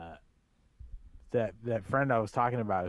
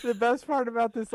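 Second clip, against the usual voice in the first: laughing; 11 semitones higher, 6 dB louder than usual. Real, not acted. Laughing outburst.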